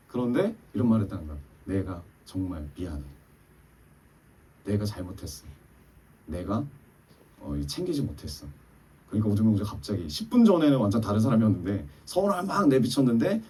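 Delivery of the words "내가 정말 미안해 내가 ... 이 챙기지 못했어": soft sincere apology. Retold or imitated. Wife repeats soft sincere apology.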